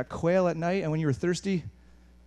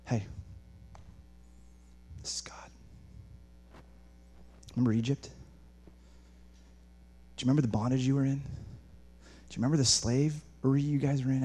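God gently reminding; 3 semitones lower, 6 dB quieter than usual. Acted. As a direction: remind them softly, with long pauses. Voices God gently reminding Israel.

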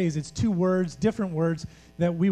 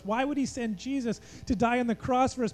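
ordinary talk

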